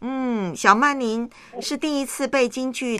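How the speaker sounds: usual speaking voice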